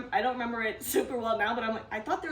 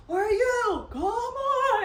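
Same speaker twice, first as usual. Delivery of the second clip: high, louder mock exasperated call; annoyed drivers yelling; 11 semitones higher, 4 dB louder, much slower than usual. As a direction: play the fed-up people waiting in line. Drivers annoyed at being held.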